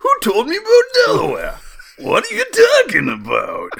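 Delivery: mock East Coast accent. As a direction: squeal it like a baffled East Coast local. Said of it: "accent is just gonna bleed over".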